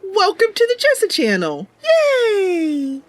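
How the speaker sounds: high, excited welcome; bright cheer. Real, not acted. Streamer cheering a new viewer into chat, high and excited. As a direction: cheer the newcomer in.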